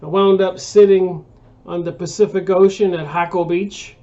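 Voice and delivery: usual speaking voice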